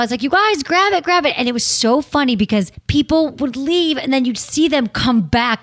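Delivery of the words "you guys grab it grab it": high-pitched, eager call to a crowd; eager urging. Retold or imitated. Show host urging the audience to take free samples, eager urging. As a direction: urge everyone to help themselves.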